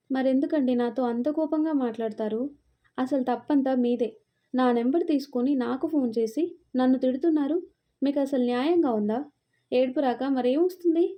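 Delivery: ordinary delivery, nothing marked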